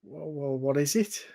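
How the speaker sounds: soft, lightly put-on Scouse; Lister's Scouse voice. Stranger answers the looming adventurer, soft Scouse. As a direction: play a quiet Scouse stranger. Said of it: "gonna go for a Lister voice".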